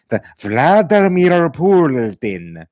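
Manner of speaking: drawn-out mock Russian "Vladimir Putin", rolled r's; weird pronunciation. Streamer mocking how Seagal says Putin's name, weird exaggerated pronunciation. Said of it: "the weird way he says Putin's name".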